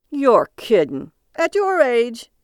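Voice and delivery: loud, slow disbelief, then high; mock the passenger's age; incredulous cabbie. Cabbie voiced in story.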